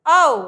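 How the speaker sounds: आओ called out loudly, lips rounded; loud vowel demo. Shown as a demonstration. Teacher demonstrating lip rounding to students, loud and clear. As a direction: project आओ so the class hears the lip shape. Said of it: "तो देखिए हमने बोला ऊ".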